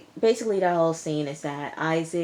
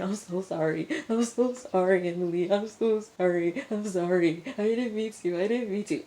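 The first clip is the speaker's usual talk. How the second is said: mock sobbing apology; 3 semitones higher than usual. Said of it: "he just keeps saying".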